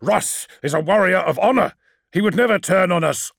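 heated, emphatic protest; indignant. Acted. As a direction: insist hotly on a brother's honour. Magnus angrily defends his brother.

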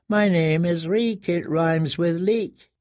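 slow, sing-song recitation; broken prisoner reciting. A narrator's reading. Prisoner repeating his name to himself, slow rote chant. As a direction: recite it like a learned lesson.

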